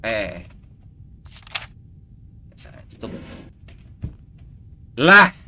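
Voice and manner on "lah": sharp loud high yelp; real startled yelp; startled shout. Real, not acted. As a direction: blurt it as the scare hits. Startled by game scare.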